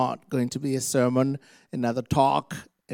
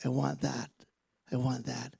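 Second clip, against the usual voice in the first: very soft, halting murmur; wince, then quietly give in; pained acceptance; 13 dB quieter than usual. Acted. Believer reluctantly accepting God's hard word, soft and pained. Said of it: "We usually go".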